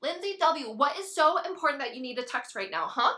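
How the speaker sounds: loud, irritated demand; sharp scolding. Acted. Confronting a friend who keeps texting, irritated. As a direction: snap out of calm into annoyance.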